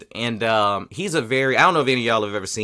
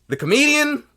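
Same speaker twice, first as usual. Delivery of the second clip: much higher and louder, disbelieving; astonished, pitched way up; incredulous emphasis; 9 semitones higher, 5 dB louder than usual. Real, not acted. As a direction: hammer home how absurd it is. Stressing the irony, disbelief.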